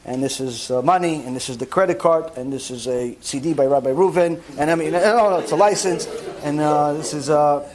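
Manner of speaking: low, even transactional voice with hesitant filler; demonstrating polite, impersonal small talk in a low monotone; cordial but detached. Shown as a demonstration. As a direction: talk with eyes down, low and unengaged. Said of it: "and that's how you talk".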